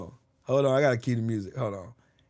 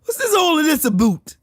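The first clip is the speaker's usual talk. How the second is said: high, loud Canadian caricature; mock a baffled Canadian with raised pitch; hammed-up Canadian accent; 13 semitones higher, 6 dB louder than usual. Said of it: "Canadian acting tough".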